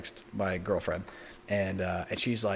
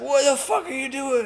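shouting; 13 semitones higher, 4 dB louder than usual. Retold or imitated. Snatching weed from security.

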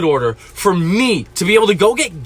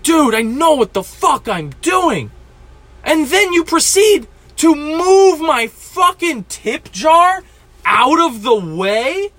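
indignant rant; 6 semitones higher, slower than usual. Real, not acted. Angry rant at customer.

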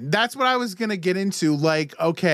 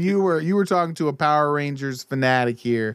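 ordinary delivery

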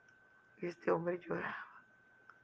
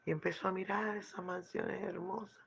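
ordinary talk

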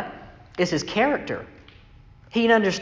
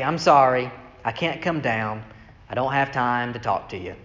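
soft, lower, gentle refusal; 8 semitones lower, 4 dB quieter than usual. Retold or imitated. Nehemiah gently declining to come down, soft. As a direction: let him down kindly and quietly. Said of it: "in a very kind of gentle way".

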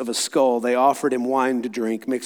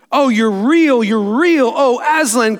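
higher, joyful exclamation; crying out; 7 semitones higher, 5 dB louder, slower than usual. Acted. Lucy finding Aslan real, joyful cry. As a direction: cry it out with relieved delight. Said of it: "cried Lucy".

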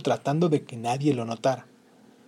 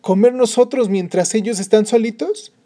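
indignant; 8 semitones higher, 7 dB louder than usual. Acted. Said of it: "dijo la mujer indignada".

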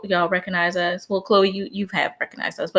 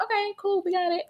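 mock chirpy yes; 11 semitones higher, 6 dB quieter than usual. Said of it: "they'd be like".

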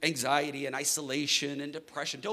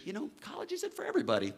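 higher, fast, dismissive teacher; mock teacher voice; 9 semitones higher, 3 dB quieter, faster than usual. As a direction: toss it off like a doubtful teacher. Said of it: "I was told by a high school teacher".

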